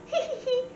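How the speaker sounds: high, squeaky hee-hee giggle; demonstrated giggle. Shown as a demonstration. Sign teacher showing students how laughing looks, mock giggle. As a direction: fake a little high-pitched laugh. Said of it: "'cause you're laughing".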